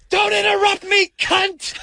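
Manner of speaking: screaming it at the top of his lungs; impersonating a friend's sudden street yell; furious shout. Retold or imitated. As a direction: explode from nothing into a howl. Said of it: "At the top, at the top of his fucking lungs".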